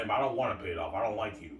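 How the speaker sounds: ordinary delivery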